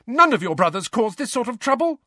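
high-pitched angry scolding; raging. A narrator's reading. Mother comparing twins with their brothers, raging. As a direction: rage at the troublemakers. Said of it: "Missus Weasley raged at the twins".

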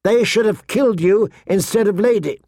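put-on high-pitched angry girl's voice, restrained volume; mild acted scream. A narrator's reading. Girl blames her sister for Lady, high mild scream. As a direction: lash out at a sister. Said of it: "she screamed at her sister".

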